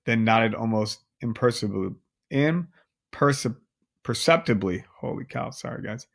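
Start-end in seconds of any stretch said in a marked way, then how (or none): 5.0-6.1 much softer, breathy apology to the listeners; whispered aside. Real, not acted. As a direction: drop out of the story and whisper an apology. Reader's aside after stumbling.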